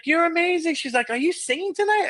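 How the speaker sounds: high fan voice; lightly hammed-up rich lady; gushing woman. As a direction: play the starstruck wealthy lady.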